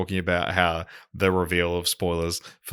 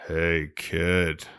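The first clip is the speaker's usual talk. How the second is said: mock Harrison Ford; 2 semitones lower, 5 dB quieter, slower than usual. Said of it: "Harrison turns to him and goes".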